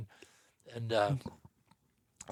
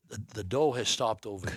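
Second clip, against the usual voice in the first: normal talking voice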